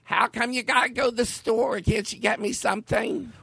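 a grandson's impression of his old Philly grandma; grandmother impression. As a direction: play his elderly grandmother nagging. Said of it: "I used to do my grandmother".

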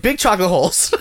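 squealing high laugh. Real, not acted. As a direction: crack up while saying it. Laughing repetition.

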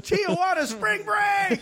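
high, slow whooping cheer; party whoop. Pretend. Joking with cohosts about tattoos, whooping high party cheer. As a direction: shout it like a spring-break partier.

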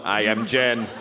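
low, stiff robot imitation. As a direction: parrot her like a robot. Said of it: "You said that like a robot".

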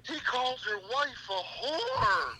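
high, drawn-out pleading protest; mock indignant protest. Employee justifies himself to angry boss, mock-indignant high protest. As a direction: retell a protest, stretching it out high.

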